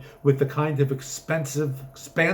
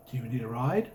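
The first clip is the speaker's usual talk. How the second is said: ordinary delivery, nothing marked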